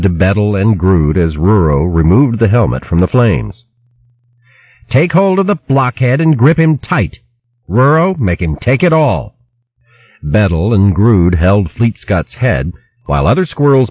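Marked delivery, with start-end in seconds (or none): 4.9-9.3 higher, urgent commands; commanding squirrel. Acted. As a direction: bark orders at the squirrels. Juka orders the squirrels.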